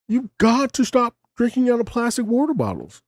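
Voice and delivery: high, put-on voice mocking the video host; mock infomercial pitchman; imitating the video guy. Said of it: "He was telling me".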